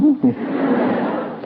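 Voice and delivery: short unvoiced chuckle; breathy laugh. Real, not acted. Laugh after friend's remark. (tawa kecil tanpa suara)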